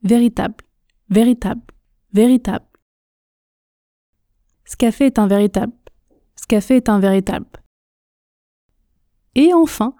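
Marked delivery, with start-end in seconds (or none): 0.0-2.7 "véritable" ending on b, L silent; dropped L. Shown as a demonstration. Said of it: "je ne prononce pas le L de véritable". 4.7-7.5 rapid phrase with dropped sounds; fast and contracted. Shown as a demonstration. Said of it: "quand je parle vite, je ne prononce pas".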